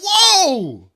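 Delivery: high, loud, startled whoas; shocked whoa. Retold or imitated. Host reacting to the Twitter beef with a shocked whoa. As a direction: pitch jumps way up in mock alarm. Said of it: "And I was like".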